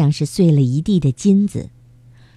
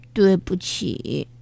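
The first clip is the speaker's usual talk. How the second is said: choked up; 3 dB quieter, slower than usual. Acted. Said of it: "花花哽咽着说".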